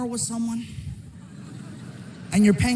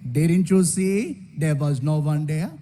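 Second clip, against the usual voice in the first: lower, slow and unbothered; calm as can be; 6 semitones lower than usual. Retold or imitated. Driver calmly explaining the empty road, slow and low. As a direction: shrug it off, totally calm. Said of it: "As calm as can be".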